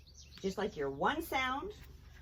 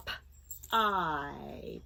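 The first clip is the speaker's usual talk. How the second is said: sounded out slowly; 9 semitones lower than usual. Shown as a demonstration. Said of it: "Let's say it slowly".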